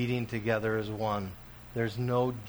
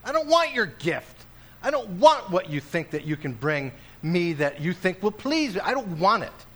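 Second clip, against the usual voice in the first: much higher, louder refusal; indignant Jesus; 6 semitones higher, 5 dB louder than usual. Acted. Preacher voices Jesus refusing the gift, indignant. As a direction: push the gift away in outrage. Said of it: "basically Jesus says".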